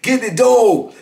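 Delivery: retold holler. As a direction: yell it down the tier. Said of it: "he hollered to the dude".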